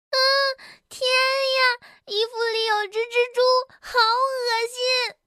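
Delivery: high-pitched wail of horror; frightened. Acted. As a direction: wail in disgust, stretching the last words. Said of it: "蹦蹦害怕得直发抖". (害怕又恶心地高声哭喊)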